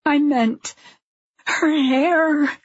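high, strained wail; distraught crying voice; tearful. A narrator's reading. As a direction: choke it out in two pieces, high. Sobbing wife answers her husband.

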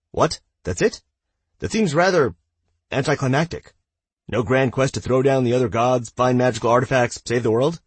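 high, loud, tumbling out of surprise; babbling in a rush. Acted. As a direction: blurt it all out at once. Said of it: "Joey babbled in a rush".

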